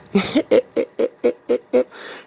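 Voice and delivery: high, gleeful witch cackle. Retold or imitated. Witch laughs after line.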